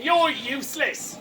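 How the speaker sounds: mildly nasty, slow put-down. Acted. Cassius turning on her friend Brutus, understated nastiness. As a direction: lean slowly on the insult. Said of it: "Cassius turns nastily on her friend".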